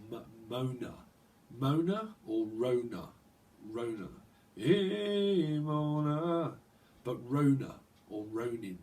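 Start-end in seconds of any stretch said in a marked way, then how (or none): 4.6-6.5 playful greeting. Pretend. Playful call to Mona.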